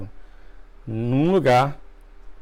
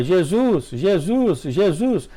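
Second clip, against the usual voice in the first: mildly raised cries, pitch up; retold shout; 5 semitones higher, much slower than usual. Dreamer calling after the departing glory of God, mild retold yell. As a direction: call out after the fading glory, lightly acted. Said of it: "E eu gritava".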